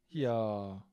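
low, drawn-out disappointed "Ja"; sad. Shown as a demonstration. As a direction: let "Ja" sink sadly. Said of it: "Das heißt traurig".

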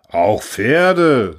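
loud, full-chested confirmation, falling at the end; proud conviction. A narrator's reading. Orator confirming to worried peasants, proudly convinced. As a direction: declare it proudly, with total conviction. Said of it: "stolz im Brustton der Überzeugung".

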